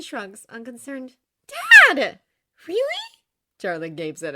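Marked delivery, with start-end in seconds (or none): 1.5-2.8 high, very loud scolding daughter; appalled shout. Acted. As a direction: cry out in disbelief at your father. Daughter aghast at her father.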